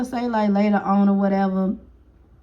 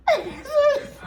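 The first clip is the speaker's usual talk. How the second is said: squealing burst of laughter; high cackling laugh. Real, not acted. Laughing at the insult.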